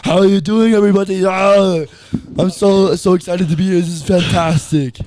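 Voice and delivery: excited Austrian strongman, high and loud; Arnold. Shown as a demonstration. Teaching how he greets everyone when excited, excited. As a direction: belt it out in a throaty, excited action-star impression. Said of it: "when he gets excited".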